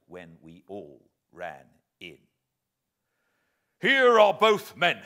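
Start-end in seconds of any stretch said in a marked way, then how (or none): 3.8-5.0 loud, high, out-of-breath call; panting. Acted. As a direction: gasp it out after the chase. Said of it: "panted the sergeant".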